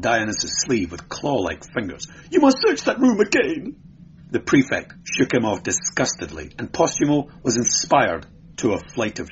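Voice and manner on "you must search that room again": high, frantic plea; shrill pleading. Acted. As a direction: beg in hysterical panic. Hysterical clerk begging.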